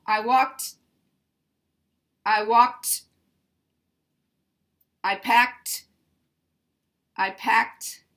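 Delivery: walked and packed with a loud final T; drilled T endings. Shown as a demonstration. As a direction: repeat packed, punching the kt. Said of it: "that's one way that you can".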